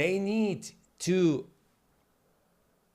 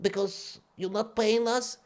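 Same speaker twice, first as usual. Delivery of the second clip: higher, softer put-on voice; 5 semitones higher, 4 dB quieter than usual. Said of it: "The crippled guy".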